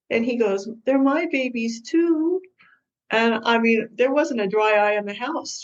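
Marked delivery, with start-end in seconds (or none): 0.9-2.4 tearful plea. Retold or imitated. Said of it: "And he starts to cry".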